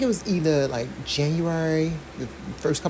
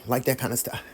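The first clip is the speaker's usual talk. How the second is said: ordinary talk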